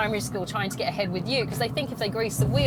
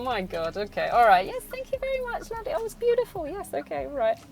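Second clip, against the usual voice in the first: mock gushing thanks; 6 semitones higher than usual. Teacher faking delight at a rushed drawing, high and gushing. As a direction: gush over the gift without meaning a word. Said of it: "And you sit there and go".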